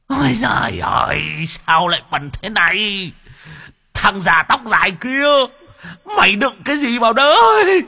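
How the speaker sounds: very high, loud wailing screech; ghost howling in disgust. Acted. Ghost in the jar wailing at the old man, shrieking high. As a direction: howl and wail as if choking on the stench. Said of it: "con vong nó rú lên".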